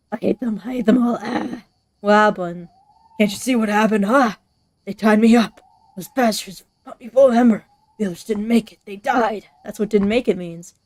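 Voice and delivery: loud, bitter survivor voice; a grieving, furious captive. Acted. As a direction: spit it at the listener like you barely escaped.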